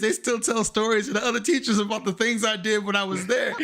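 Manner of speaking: can't stop laughing while telling it; laughing through it. Real, not acted. Laughing while telling the funeral story.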